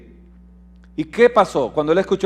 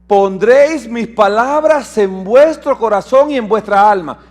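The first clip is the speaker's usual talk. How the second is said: louder, ringing scripture reading; proclaiming scripture; 4 semitones higher, 6 dB louder than usual. Acted. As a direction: declaim the verse to the church. Reads scripture aloud to congregation.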